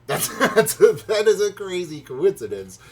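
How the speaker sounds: amused laugh as you start. Real, not acted. Laughs at the coincidence.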